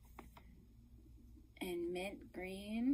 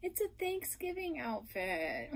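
ordinary talk

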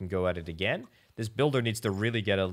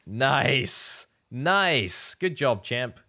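excited cheer; 4 semitones higher, 5 dB louder, much slower than usual. Real, not acted. Unit lands a hit.

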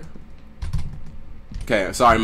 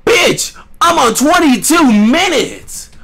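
high, loud, drawn-out whine; loud complaint; 6 dB louder, slower than usual. Real, not acted. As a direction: yell it out slowly in disbelief. Complaining loudly at the game.

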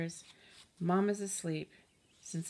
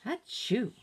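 acted sneeze; 5 semitones higher than usual. Mom sneezes.

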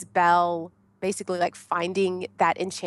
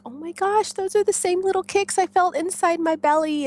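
amazed; 10 semitones higher than usual. Retold or imitated. Feeling baby's kicks.

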